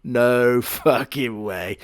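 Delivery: slow, laughing through no fucking way; laughing disbelief. Real, not acted. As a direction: break into a laugh mid-line. Disbelief at cat backstory.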